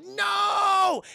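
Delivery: high, loud drawn-out cry; long hammed-up wail of protest; mock dramatic shout. Imitating Peter Boyle shouting after his dog, mock dramatic shout.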